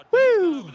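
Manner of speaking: very high cheering whoop. Real, not acted. Gamer whooping after the goal. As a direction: let out a joyful woo.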